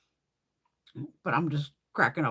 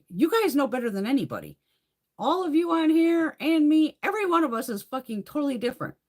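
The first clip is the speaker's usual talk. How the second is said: angry rant; 10 semitones higher, 4 dB louder than usual. Retold or imitated. Arguing with her doctors.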